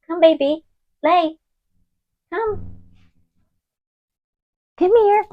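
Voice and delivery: high, gentle call to a dog; coaxing pet voice. Real, not acted. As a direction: sweet-talk the puppy over. Coaxing her dog to come.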